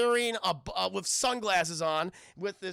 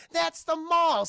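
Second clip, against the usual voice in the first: mocking girls; 10 semitones higher, 7 dB louder, slower than usual. Girls correcting him in retold scene.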